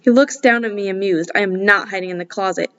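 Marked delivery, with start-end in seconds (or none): none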